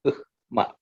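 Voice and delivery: Ukhma with h before m; as written. Shown as a demonstration. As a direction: separate the throaty h from ma in Ukhma. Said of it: "should be pronounced is".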